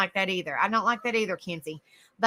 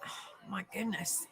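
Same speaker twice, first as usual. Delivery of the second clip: slow, voiceless sigh of words; whispered exasperation; 2 semitones lower, 16 dB quieter, slower than usual. Real, not acted. Speaker bothered by a burning eye, whispering in discomfort. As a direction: whisper it slowly on the breath.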